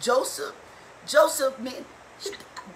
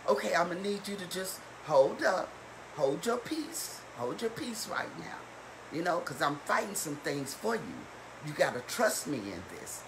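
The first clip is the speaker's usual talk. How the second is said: lightly voiced Lord, softer and lower; hushed, low counsel, as if from God; the Lord reassuring; 5 semitones lower, 6 dB quieter than usual. Preacher voicing the Lord to the congregation, quiet reassurance. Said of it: "Or the Lord tell us".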